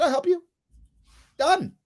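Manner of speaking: high, bright-toned quick offer; cheerful offer. Retold or imitated. Dad to daughter asking for help, cheerful. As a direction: say it like the deal is done. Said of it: "I said".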